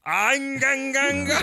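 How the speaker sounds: high, shouted alien chant; alien radio voice. Acted. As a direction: bark out like a strange creature. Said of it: "Radio voice".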